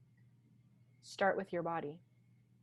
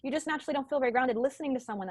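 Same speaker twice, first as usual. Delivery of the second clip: higher and fast, rushed head voice; 6 semitones higher, 3 dB louder, much faster than usual. Shown as a demonstration. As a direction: rattle it off quickly up high. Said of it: "I talk like this".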